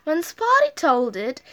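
usual speaking voice

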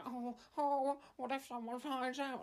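high, whimpering nervous voice; whimpering Edmund. Retold or imitated. Edmund fretting while burying the cow, high whimpering voice. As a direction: fret like a scared man. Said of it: "he kept whimpering and being all nervous".

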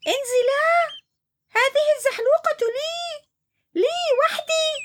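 very high, bossy hippo; bossy order. Acted. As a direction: order them off the slide. (عنتر يأمرهما بالنزول)

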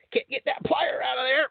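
high, strained, whiny struggle; straining frustration. Pretend. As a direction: grunt it out like you're fighting the plastic. Struggling to open tool packaging.

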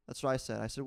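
ordinary talk

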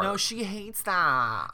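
high, drawn-out campy objection; mock dramatic protest. Co-host protests the Grasshopper nickname, mock dramatic wail. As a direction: wail it slowly in mock dismay.

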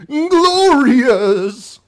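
high, loud, stretched-out villain cry; Shao Kahn. Retold or imitated. Critic mocking how the villain really sounds, high silly shout. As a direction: squawk it out in mock triumph. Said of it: "but no, it's".

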